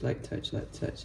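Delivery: fading into a soft whisper. Retold or imitated. Ancestors whispering advice to the cook, a soft whisper. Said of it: "The ancestors said".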